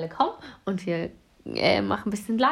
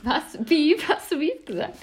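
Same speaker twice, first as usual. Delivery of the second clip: high, incredulous question; can't believe what she heard; surprised disbelief; 7 semitones higher than usual. Real, not acted. Incredulous at odd answer.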